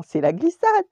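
high, very loud storybook whoop; excited announcement. A narrator's reading. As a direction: shout it gleefully as the sled takes off. Dog launches the sled ride. (cri enjoué, aigu et fort)

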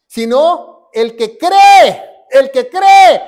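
loud, slow emphasis on "cree"; shouted preaching. Real, not acted. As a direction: drive "cree" home with a shout. Predicador recalca la respuesta. (grito de predicador, alargando "cree")

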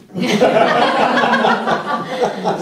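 loud, high burst of real laughter; big laugh. Real, not acted. Laughing at a buyer who agreed to ten million cash, big real laugh. Laughing at the offer's easy acceptance.

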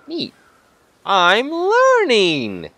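high, loud, drawn-out cheer; gleeful exclamation. Real, not acted. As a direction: stretch the words out in loud delight. Celebrates learning a game recipe.

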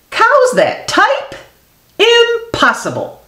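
high, incredulous squeak of a farmer; disbelieving farmer. Acted. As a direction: Farmer Brown can't believe his cows type, high and incredulous.

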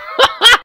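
high-pitched cackle capping the impression; mocking laugh.

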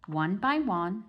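plain reading voice

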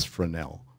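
Fresnel as fruh-NEL, S silent; "Fresnel" the French way, fruh-NEL; accepted French pronunciation. Shown as a demonstration. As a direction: drop the S in Fresnel, short first syllable. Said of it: "French pronunciation".

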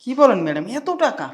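loud, high-pitched amazement; exclaim, pitch shooting up; astonished delight. Acted. Guard told all the money is his, astonished.

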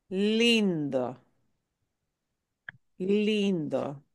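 lindo with a long stressed first syllable; lindo, weight on "lin", falling away after. Shown as a demonstration. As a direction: model lindo carefully, leaning on "lin". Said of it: "stress the second to last".

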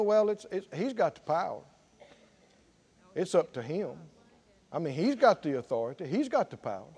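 low, soft resigned church voice; a hint of a shrugging believer giving up; mock passive churchgoer. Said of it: "throw up their hands and go".

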